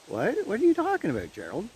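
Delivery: high-pitched mock disbelief; baffled question. Baffled man questioning his friend Gerald, acted-out surprise. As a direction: act out being taken aback, pitch jumping up.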